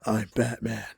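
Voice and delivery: low, hushed superhero voice; Batman as imagined. Retold or imitated. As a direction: say it low and understated, like a dark hero. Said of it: "when I think Batman, I think".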